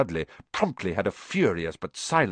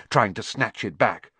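plain reading voice

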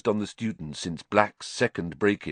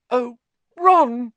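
much higher, louder cry of relief; emotional cry; 8 dB louder than usual. Acted. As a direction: burst out, overwhelmed with gratitude. Hermione overwhelmed, crying out.

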